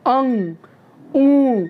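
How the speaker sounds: "अंग" and "ऊ" said with the sound sent through the nose; nasal vowel demo. Shown as a demonstration. Teacher showing students a nasal vowel, voiced through the nose. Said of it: "nasal sound जिसे कहा जाता है".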